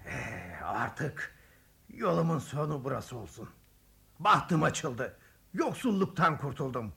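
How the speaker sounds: quiet musing traveler. Acted.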